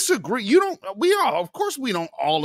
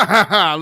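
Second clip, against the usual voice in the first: a quick loud burst of laughter; short laugh; 7 dB louder than usual. Real, not acted. Host laughing at co-host's joke.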